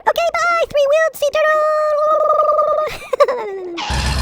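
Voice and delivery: very high squeaky farewell, last word held long; high sing-song send-off trailing into laughter; silly goodbye. Pretend. Calling goodbye to the toy turtle.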